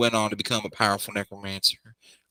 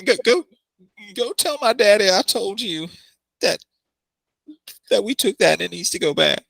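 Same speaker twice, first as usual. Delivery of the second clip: put-on high girly voice; 8 semitones higher than usual. NPC girl high voice.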